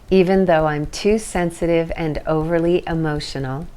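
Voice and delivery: steady call-and-repeat affirmation voice; tapping script. Acted. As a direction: lead the group line by line for them to echo.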